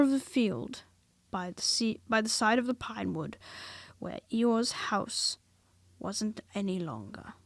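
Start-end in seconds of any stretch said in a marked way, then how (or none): none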